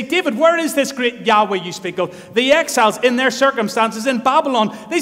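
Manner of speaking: loud, rising sermon cry; full-force preaching. Acted. Preacher urging his church, loud and rising. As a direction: preach it hard to the congregation.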